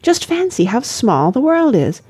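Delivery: put-on high-pitched girlish wonder; lively young lady, tickled; chatty, delighted girl. A narrator's reading.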